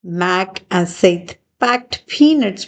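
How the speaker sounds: usual speaking voice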